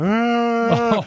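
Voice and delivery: high wailing cry, imitating a sob; mock child crying wail. Daughter starts crying on the bench, mock high wail. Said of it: "she starts crying".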